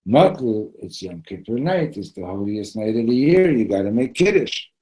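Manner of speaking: loud acted pleading, building; insistent urging. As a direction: press the sleepy friend, voice climbing. Friend insists, storytelling.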